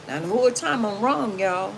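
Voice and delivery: normal talking voice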